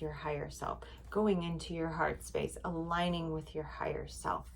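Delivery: whispered and drifting down; soft whisper. Real, not acted. Guiding viewers into bedtime breathing, softly whispered.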